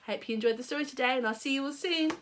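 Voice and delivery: fast friendly goodbye; fast sign-off. Real, not acted. Reader saying goodbye to listeners. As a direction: wrap up quickly.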